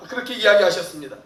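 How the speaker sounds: usual speaking voice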